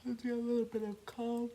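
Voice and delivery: high, loud words riding a big breath; sighing into speech. Real, not acted. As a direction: talk through a yawny exhale. Sighs or yawns while settling in.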